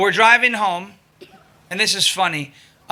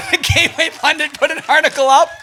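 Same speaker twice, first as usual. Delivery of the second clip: usual speaking voice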